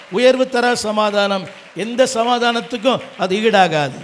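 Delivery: loud, slow, stretched refrain, building to a shout; preaching at full force. Real, not acted. Preacher proclaiming peace to the congregation, full-force drawn-out preaching.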